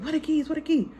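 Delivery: frantic, high-pitched search for the keys; panicked asking. Retold or imitated. Husband hunting for car keys after the knife cut, panicked. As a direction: rush the question, voice climbing high.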